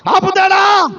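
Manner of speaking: high, called-out rhetorical question. Real, not acted.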